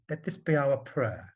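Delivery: plain reading voice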